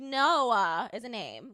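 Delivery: loud, drawn-out mocking "Noah"; teasing mom. Mom making fun of her kid's drawl, teasing. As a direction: mimic a mom making fun, stretching the name. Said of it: "my mom used to, like, make fun of me".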